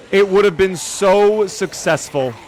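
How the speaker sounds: loud, high, slow theatrical wail; slow dramatic lament. Acted. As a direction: stretch every word like a tragic hero.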